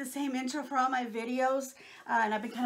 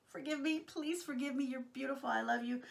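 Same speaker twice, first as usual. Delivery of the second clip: ordinary talk, nothing marked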